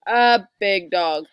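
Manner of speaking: low, loud, slow father voice; papa bear. A narrator's reading. Papa answering Mama's objections, low booming voice. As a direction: boom it out slowly as Papa.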